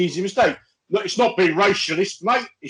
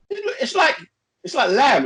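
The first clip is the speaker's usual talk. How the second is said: mock dismay; 10 semitones higher, slower than usual.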